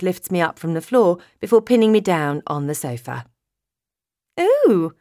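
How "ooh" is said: high surprised squeak. Acted. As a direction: squeak it out, startled. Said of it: "I squeak".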